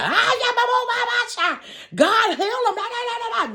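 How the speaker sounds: high shrieking church ladies; church sisters screaming. Retold or imitated. Church sisters in the back shriek prayers over the fallen boy. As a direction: wail it like praying sisters. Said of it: "the other sisters in the back screaming".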